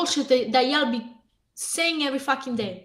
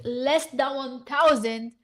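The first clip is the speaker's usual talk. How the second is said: slowly, dwelling on each word; slow emphatic repeat; slower than usual. Real, not acted. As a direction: let it sink in, slowly.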